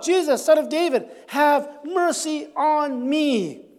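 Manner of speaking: loud pleading cry, drawn out on mercy; calling out. Acted. Blind beggar calling to Jesus over the crowd, loud pleading cry. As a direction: beg for mercy at the top of your voice. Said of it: "he called out".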